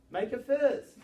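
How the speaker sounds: a sharp classroom order to clench; loud command. Acted.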